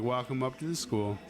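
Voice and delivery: normal talking voice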